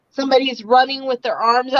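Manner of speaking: normal talking voice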